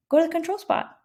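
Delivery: high-pitched teacher voice, re-enacted; teacher's prompt. Teacher retells sending a frustrated student to calm down, high-pitched prompt.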